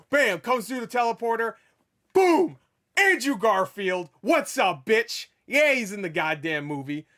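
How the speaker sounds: loud, high, explosive announcing; excited hype. Real, not acted. Fan announcing Andrew Garfield's surprise entrance, wildly excited. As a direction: punch out each reveal like a hype man. Hyping a big surprise cameo reveal.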